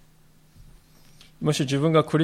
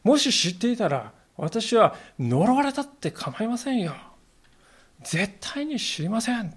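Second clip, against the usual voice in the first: Peter's desperate denial; 6 semitones higher than usual. Retold or imitated. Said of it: "ペテロはついにここに来て". (必死に否定するペテロ)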